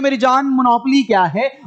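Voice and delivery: ordinary talk, nothing marked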